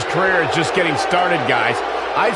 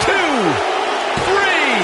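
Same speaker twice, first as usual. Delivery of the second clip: excited count; 10 semitones higher than usual. Acted. Excited referee count on the pin.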